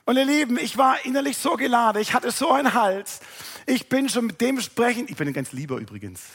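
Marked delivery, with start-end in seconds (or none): none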